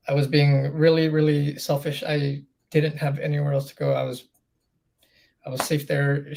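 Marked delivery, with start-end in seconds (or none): none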